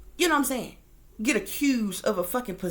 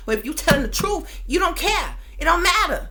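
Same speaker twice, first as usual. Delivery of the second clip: louder and higher, defiant; defiant shout; 7 semitones higher, 6 dB louder than usual. Real, not acted. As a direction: raise it up and dare them. Defiant rant at police.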